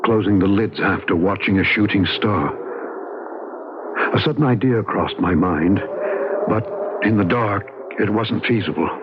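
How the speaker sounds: calm, even storytelling voice; story narration. A narrator's reading. David recounting that night to the listener, reflective narration. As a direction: tell it looking back, measured.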